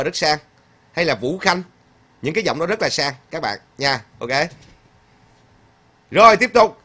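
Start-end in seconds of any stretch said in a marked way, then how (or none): 6.1-6.8 raised, high call to move on; loud cheerful cue. Real, not acted. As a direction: call out the next item to the chat. Host moves to next comment.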